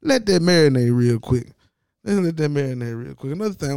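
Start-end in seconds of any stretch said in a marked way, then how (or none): 0.0-1.5 loud, emphatic. Real, not acted. Telling viewers to ponder, loud emphatic. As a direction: let that marinate said loud and firm. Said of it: "repeated line".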